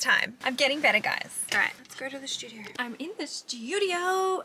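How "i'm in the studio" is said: high, stretched-out singsong; singsong announcement. Real, not acted. Vlogger telling viewers they've reached the studio, singsong. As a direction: draw it out high and playful.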